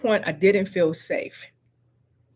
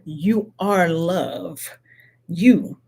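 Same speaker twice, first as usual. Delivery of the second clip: slow emphasis; much slower than usual. Real, not acted.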